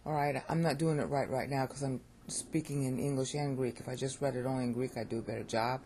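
ordinary delivery

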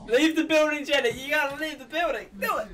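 high, loud urging; urgent calling out. Real, not acted. Urging Jenny on through the screen, yelling. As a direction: yell it up high like egging someone on.

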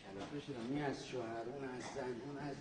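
very soft, low grumble to himself; exasperated mutter. Acted. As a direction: sigh it out under your breath.

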